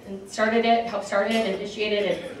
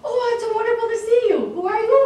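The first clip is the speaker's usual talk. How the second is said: put-on much higher, fast, sweet old lady; old patient's voice; 13 semitones higher, 5 dB louder, faster than usual. Forgetful Alzheimer's patient greeting a visitor, high and sweet. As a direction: gush happily, then ask who you are. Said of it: "She's like".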